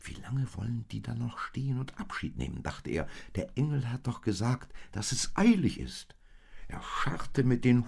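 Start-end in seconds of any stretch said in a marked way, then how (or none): 0.0-6.1 higher-pitched, fretting thought; impatient inner grumble, rising. A narrator's reading. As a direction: fret about the delay. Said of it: "langsam ungeduldig".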